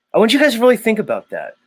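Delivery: urging the viewers, fired up; excited. Real, not acted. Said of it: "I'm getting excited".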